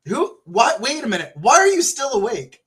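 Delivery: high, startled string of questions; incredulous surprise. Real, not acted. Streamer finding a friend up late, incredulous. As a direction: do a double take, pitch jumping up. Startled by viewer still awake.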